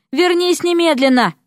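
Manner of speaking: high, urgent command; calling after her. A narrator's reading. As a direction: order her back down the corridor. Calling after departing wife.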